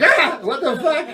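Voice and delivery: high startled reaction; acted sudden fright; startled yelp. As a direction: jump with a high-pitched cry of alarm. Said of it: "fucking startle you".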